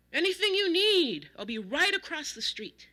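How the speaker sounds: high, loud hearty neighbor call; big jovial over-loud voice; cheerful too-loud exclamation. A narrator's reading. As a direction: boom it out cheerfully. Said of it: "cheerfully exclaimed in his always too loud voice".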